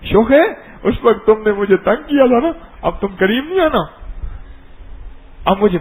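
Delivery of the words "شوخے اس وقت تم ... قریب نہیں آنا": a touch of sulky scolding, raised; lightly hammed-up huffy child; child's mock anger. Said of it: "اب اس غصہ ہے".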